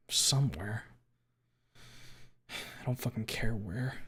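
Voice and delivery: breathy whisper. Real, not acted. Dazed narrator in the back of a cop car, whispering. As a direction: let the word fade into a whisper.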